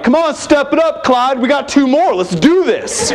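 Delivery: put-on high excited teen call, rushing the others; eager kids. Kid urging a dad at the oil change, high and eager. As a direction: hype up the crew like a keen teenager.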